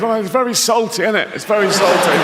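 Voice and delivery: lightly voiced surprise at the salt; a hint of put-on wonder; mock surprised swimmer. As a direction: act a little surprised at the taste, quickly. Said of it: "he goes".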